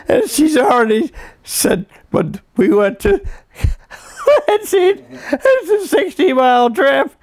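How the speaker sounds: high, laughing through the quote; barely getting the words out for laughing. Real, not acted. Son reading his mother's funny letter, laughing.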